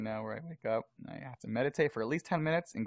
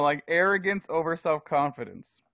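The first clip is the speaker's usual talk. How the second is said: ordinary talk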